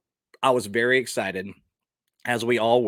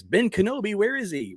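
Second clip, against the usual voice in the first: lightly voiced young princess, pitched higher; a hint of Leia's plain American line; mild imitation of Carrie Fisher's Leia; Leia without the accent; 9 semitones higher than usual. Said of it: "where she doesn't have the accent".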